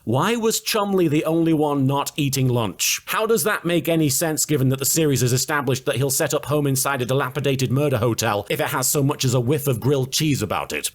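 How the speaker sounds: normal talking voice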